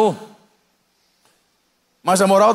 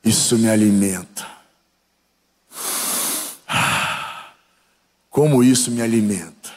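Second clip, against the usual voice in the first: sniffing demon; 8 semitones lower than usual. Retold or imitated. Demon feeding on the disciple's anger, low sniffing gloat. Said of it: "Essas fungadas que o demônio fez".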